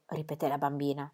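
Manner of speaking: ordinary delivery, nothing marked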